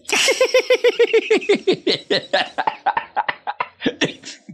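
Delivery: long high scheming cackle; evil laugh. Acted. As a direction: cackle on and on with glee. Villain laughs, leading guests away.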